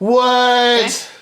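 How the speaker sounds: high, loud disbelief; shocked yell. Real, not acted. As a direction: shriek it in real astonishment. Shocked at news about corporate donations.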